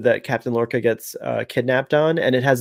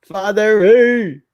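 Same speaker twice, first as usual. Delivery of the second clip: loud, stretched-out higher call; 7 semitones higher, 5 dB louder, much slower than usual. Pretend. Calling Father E again by name, drawn-out loud call. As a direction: sing out the name, long and loud.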